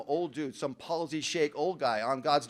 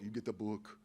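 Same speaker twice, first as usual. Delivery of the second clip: much lower and softer, a hint of the old guy; old-timer's gruff order; 8 semitones lower, 12 dB quieter than usual. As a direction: hand down the line like a low quiet order. Voicing old-timer Carl.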